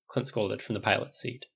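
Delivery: ordinary delivery, nothing marked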